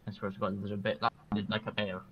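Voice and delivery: usual speaking voice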